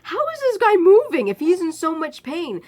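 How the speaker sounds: can't believe it; disbelief. Retold or imitated. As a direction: narrator doubting the screamer's pain. Narrator's incredulous thought.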